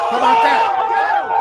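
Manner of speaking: high, slow, loud laughing shout; triumphant shout. Real, not acted. Host gloating to the audience, loud and high. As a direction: crow it out in victory.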